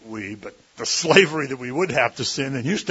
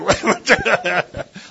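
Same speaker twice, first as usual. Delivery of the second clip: laugh; 8 semitones higher, 7 dB louder than usual. Real, not acted. Laughs at own joke.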